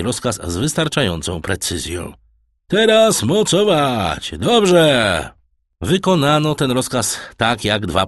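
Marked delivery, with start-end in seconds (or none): 2.7-5.3 loud, high, slow deck command; shouted command. A narrator's reading. Edmund directs the sailors at the helm, loud shout. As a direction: bark it out to the crew.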